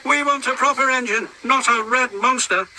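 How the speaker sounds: high, quick mocking truck voices; jeering trucks. Trucks jeering at James, high mocking voices. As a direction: sneer at him like silly trucks.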